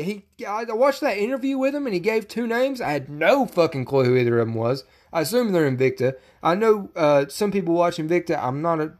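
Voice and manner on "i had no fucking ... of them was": ordinary delivery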